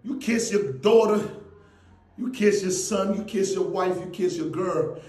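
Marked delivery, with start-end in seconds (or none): none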